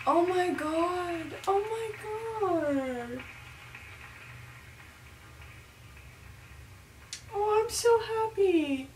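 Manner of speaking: high, soft, drawn-out awe; tiny, high, emotional voice after being moved; overcome with emotion. Real, not acted. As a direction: softly whimper with happiness, stretching it out. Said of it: "kind of emotional after watching that".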